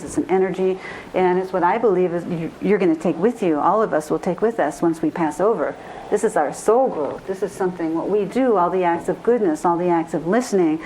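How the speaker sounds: ordinary talk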